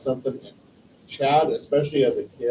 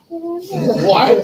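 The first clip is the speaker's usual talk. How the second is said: shocked what; 7 dB louder than usual. Retold or imitated. Hearing friend came out.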